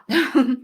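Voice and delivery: brief chuckle after speaking; short laugh. Real, not acted. Reaction to long list.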